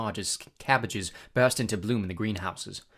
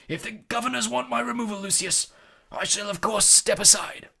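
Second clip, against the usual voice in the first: headmaster; 11 semitones higher, 3 dB louder than usual. A narrator's reading. Headmaster answers Malfoy formally.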